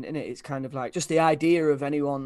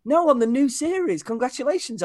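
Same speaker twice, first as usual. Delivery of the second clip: high, loud gushing congratulations; imitating an excited fan; insistent excited fan; 10 semitones higher, 6 dB louder than usual. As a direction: push the news on him.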